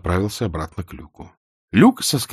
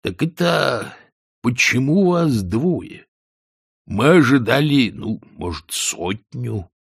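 slow, stretched-out character voice; leisurely guard sergeant; 3 semitones higher, slower than usual. A narrator's reading. Sergeant asks the captives why only two came, leisurely. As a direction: draw the words out at ease.

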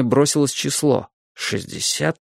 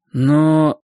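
falsetto squeak; 3 semitones higher, 4 dB louder than usual. A narrator's reading. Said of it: "запищал фальцетом".

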